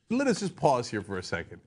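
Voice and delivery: ordinary talk, nothing marked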